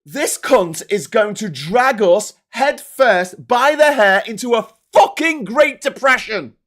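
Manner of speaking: loud, furious rabble-rousing delivery; emotive language. Shown as a demonstration. Showing politicians emotive rhetoric, loud angry tirade. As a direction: build to a thundering, hammered-out finish. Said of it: "use emotive language".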